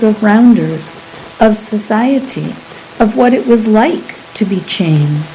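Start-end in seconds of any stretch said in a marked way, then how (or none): none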